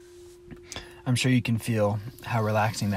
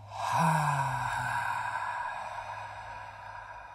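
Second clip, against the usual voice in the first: long unvoiced lion's breath ha; breathy ha exhale; 5 dB quieter than usual. Shown as a demonstration. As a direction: push the air out on a long ha. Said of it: "making the ha sound".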